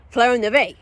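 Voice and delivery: spell incantation. Acted. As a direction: cast the spell. Dathar casts a spell.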